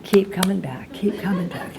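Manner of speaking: mock slogan fading from soft to a whisper. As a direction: let the slogan die away into a whisper.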